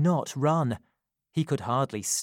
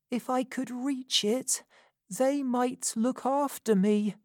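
put-on hushed private thought of a hurt boy; inner thought; 10 semitones higher than usual. Wounded boy thinking to himself, lightly voiced boy. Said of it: "he thought".

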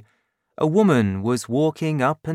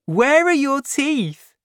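much higher, cruel taunting voice; mocking young man; 14 semitones higher, 4 dB louder than usual. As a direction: sneer it out in a shrill put-on jeer. Young man mocks Fantine.

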